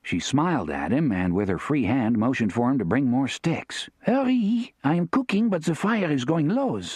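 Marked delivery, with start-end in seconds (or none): 4.0-6.8 put-on high French-accented voice, urging; French woman. A narrator's reading. Therese calling P I for more sticks, French accent. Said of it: "she said".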